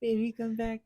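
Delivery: sing-song it like a cheesy breakup ballad; mock pleading. Retold begging ex not to leave.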